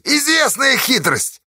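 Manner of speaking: high, slow knowing shout; rabble-rousing. A narrator's reading. Pozdey calling it a trick, rousing shout. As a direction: stir them with the accusation. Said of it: "подогревал народ Поздей".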